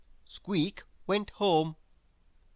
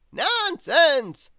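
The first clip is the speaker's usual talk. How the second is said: high, slow, loud wizard; a grand old wizard, astonished; 11 semitones higher, 9 dB louder than usual. Acted. The Wizard puzzled at Grizzle's noises, high wizard voice.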